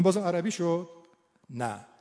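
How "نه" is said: normal talking voice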